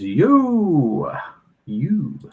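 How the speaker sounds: high swooping, stretched-out call; loud, high, elongated shout-out; drawn-out greeting. Retold or imitated. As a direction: sing out a long hooting greeting. Reads a chat greeting, drawn out.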